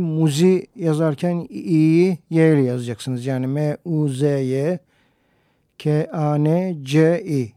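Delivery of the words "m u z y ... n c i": slow, letter by letter spelling; spelled out letter by letter. Shown as a demonstration. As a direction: spell it out, each letter apart. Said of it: "nasıl yazıldığını söyleyeyim".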